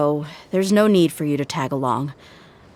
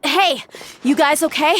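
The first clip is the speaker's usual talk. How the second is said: higher call across the room; raised, worried call; 11 semitones higher than usual. Acted. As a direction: shout to check on them. Calling to trapped companions.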